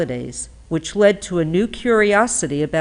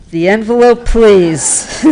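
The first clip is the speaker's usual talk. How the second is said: loud, showy awards-show host; Oscars presenter voice; 4 semitones higher, 12 dB louder than usual. Pretend. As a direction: call for the envelope like Oscar night. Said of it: "this is how they do it in the Oscars".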